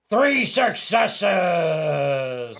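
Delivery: excited shout. Real, not acted. Dramatic dice result reveal.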